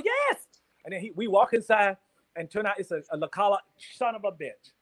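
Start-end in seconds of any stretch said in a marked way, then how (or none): 0.0-0.4 very high falsetto yes; squeaky yes. Retold or imitated. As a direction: pop it up into falsetto. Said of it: "And I go".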